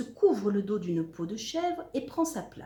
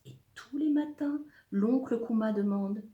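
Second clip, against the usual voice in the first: ordinary delivery, nothing marked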